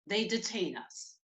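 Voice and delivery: ordinary talk, nothing marked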